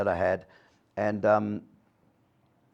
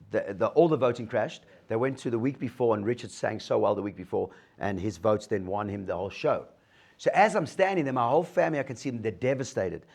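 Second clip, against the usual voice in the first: ordinary delivery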